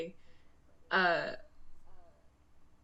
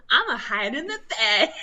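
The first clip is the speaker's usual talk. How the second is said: talking through a laugh; 3 semitones higher, 10 dB louder than usual. Real, not acted. Telling friends about hiding at work, laughing. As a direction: giggle it out, amused at herself.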